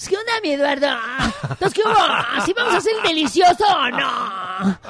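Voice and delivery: mujer ñera aguda, riéndose; voz de ñero. Pretend. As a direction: coquetea aguda y con risa. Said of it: "que tuviera esa voz y te hablara". (mujer ñera seduciendo a Eduardo, aguda)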